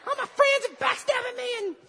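very high, loud mock wail; whiny despairing victim. Preacher voicing Josh feeling God left him, high mock whine. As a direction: squeal out the self-pity in a put-on tiny voice. Acting out Josh's despairing complaint.

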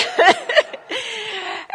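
high burst of real laughter. Real, not acted. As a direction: laugh out at your own story. Laughing at her own story.

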